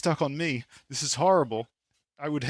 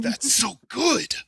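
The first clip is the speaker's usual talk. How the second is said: high, slow, delighted cry; delighted exclamation; 12 semitones higher, 8 dB louder, much slower than usual. Retold or imitated. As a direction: gush with sudden delight. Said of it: "and I'm like".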